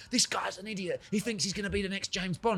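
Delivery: high-pitched imitation of an outraged rival; imitating someone scandalised; mock indignation. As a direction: scoff in a high, put-on voice. Voicing the rival's indignant reaction.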